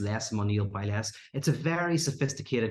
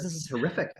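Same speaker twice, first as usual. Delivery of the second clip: higher-pitched mock dismay; exasperated complaint, pitched up; dismayed protest; 6 semitones higher, faster than usual. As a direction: act out a man appalled by his lot. Acted protest of trapped gambler.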